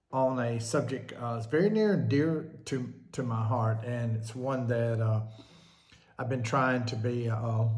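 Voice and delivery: ordinary delivery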